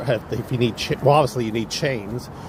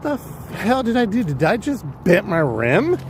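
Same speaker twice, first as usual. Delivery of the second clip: retold shock; 8 semitones higher than usual. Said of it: "I was so pissed".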